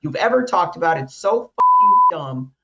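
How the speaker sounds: furious swearing; loud frustrated venting; angry rant. Real, not acted. Rant about hated cards.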